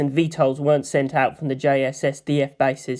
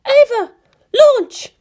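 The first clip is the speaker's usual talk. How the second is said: loud, much higher command; yelled launch order; 7 dB louder, much slower than usual. Acted. Misato yells the launch order to the crew, loud and high. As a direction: shout the launch. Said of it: "yelled Misato".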